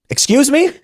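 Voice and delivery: a judge caught off guard, voice jumping way up; astonished judge. Retold or imitated. Judge hearing the defendant was coerced, shocked high query. Judge startled by plea answer.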